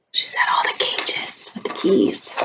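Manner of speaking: hushed secret whisper. Real, not acted. Mother whispering the secret to her daughter, hushed. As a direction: whisper it like a conspiracy.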